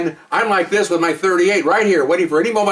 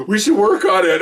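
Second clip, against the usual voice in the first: laughing through pleading; 4 semitones higher, 3 dB louder than usual. Real, not acted.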